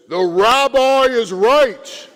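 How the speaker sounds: big voice of God. Acted. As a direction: boom it out like God from above. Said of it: "this big voice says".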